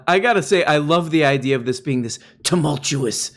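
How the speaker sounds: normal talking voice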